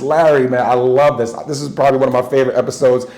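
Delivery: ordinary talk, nothing marked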